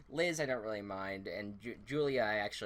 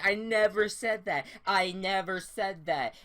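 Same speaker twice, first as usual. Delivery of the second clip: loud, high, insistent repeat; mock yelled I never said that, twice; retold yelling denial; 8 semitones higher, 7 dB louder than usual. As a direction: shout the denial back at her. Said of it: "they both start crying. They're f-, yelling".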